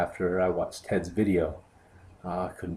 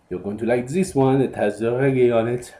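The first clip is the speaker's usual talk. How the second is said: put-on higher, louder silly French accent; hammed-up French accent, raised in pitch; stupid French accent; 5 semitones higher, 6 dB louder than usual. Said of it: "in a stupid French accent".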